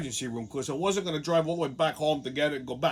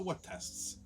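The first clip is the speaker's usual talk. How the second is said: whispered disbelief; 14 dB quieter than usual. Retold or imitated. Incredulous question to hospital.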